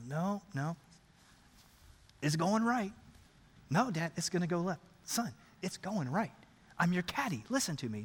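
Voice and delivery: low, soft insistence; restrained greenside bickering; hushed argument. Acted. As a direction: argue under your breath. Acted hushed father-son argument on green.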